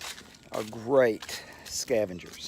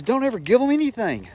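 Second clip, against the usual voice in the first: much higher mock voice of the old supervising teacher; 11 semitones higher, 4 dB louder than usual. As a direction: squeak out the teacher's warning in a high put-on voice. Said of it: "He goes".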